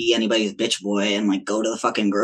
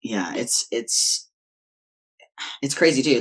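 usual speaking voice

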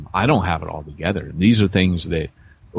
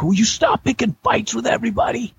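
high-pitched imitation of a scolding wife; mock exasperation, pitched way up; exasperated wife. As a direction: scold in a put-on high wifely voice. Voices his exasperated wife scolding.

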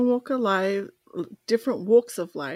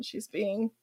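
usual speaking voice

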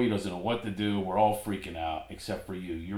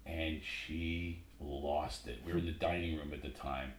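quiet at night; 4 semitones lower, 5 dB quieter than usual. Real, not acted. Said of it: "we have to be a little quiet".

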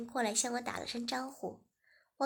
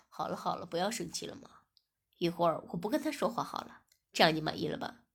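lower, steady man's voice for 文轩; male lead's voice; 6 semitones lower, faster than usual. Acted. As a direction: speak as the calm young boss. Narrator voices male lead. (低沉的男主角声音)

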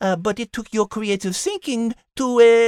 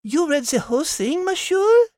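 put-on French-accented question rising high at the end; 3 semitones higher than usual. French character questioning the narrator about reading, French accent. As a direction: ask it incredulously in a French accent.

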